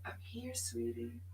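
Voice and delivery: soft, high, gentle comfort; hushed loving voice of the soul; tender reassurance. Retold or imitated. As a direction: comfort her softly. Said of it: "my soul would say".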